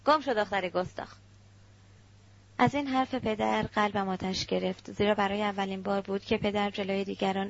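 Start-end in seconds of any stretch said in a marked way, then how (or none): 0.1-1.2 sharp angry dismissal, hit hard at the start. A narrator's reading. Father dismisses his daughter before relatives, angrily. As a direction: order out in contained anger. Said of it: "با عصبانیت رو به من کرد و گفت".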